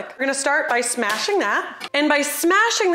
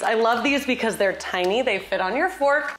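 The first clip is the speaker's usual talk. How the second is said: normal talking voice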